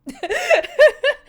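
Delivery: loud high burst of laughter; squealing giggle. Real, not acted. Laughing after the quoted line.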